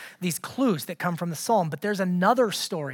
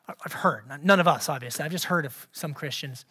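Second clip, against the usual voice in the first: low, soft deadpan aside; sarcastic; 6 semitones lower, 5 dB quieter than usual. Real, not acted. Preacher teasing the congregation about their attitudes, dry sarcasm. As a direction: dryly pretend nobody here is guilty. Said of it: "I'm being sarcastic, by the way".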